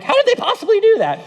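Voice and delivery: high-pitched incredulous question; hammed-up bewilderment; mock disbelief. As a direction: squeak it out in baffled disbelief. Acting baffled onlookers' reaction.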